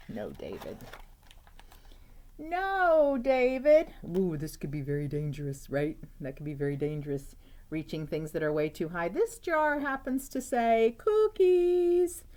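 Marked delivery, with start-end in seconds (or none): 0.1-0.8 a low, soft stern mother's warning; low stern mom. Acted. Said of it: "David's mom always said". 2.4-3.9 high, loud stern mother calling out; scolding mom. Acted. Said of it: "David's mom always said". 9.1-12.2 high and slow, reading the book text; slow, high storybook read. Acted.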